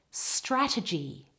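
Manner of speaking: slow "Strategy", all the weight on STRA; stress on first syllable. Shown as a demonstration. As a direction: model "Strategy" carefully, front-stressed. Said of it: "all of the stress on the first syllable".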